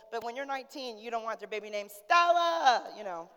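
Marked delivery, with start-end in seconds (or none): 1.9-2.9 name blurted loud and stretched out; comically drawn-out, louder name; mock distaste. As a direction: exaggerate the dreaded name for laughs. Mocks the unwanted name.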